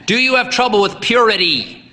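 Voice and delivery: high, booming demand; demanding old priest. A narrator's reading. Old priest demanding an answer from the boy. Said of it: "he demanded".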